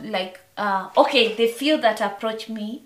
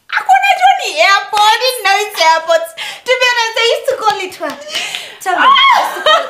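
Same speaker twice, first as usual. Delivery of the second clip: laughing; 11 semitones higher, 5 dB louder, much faster than usual. Real, not acted. Laughing at own mistake.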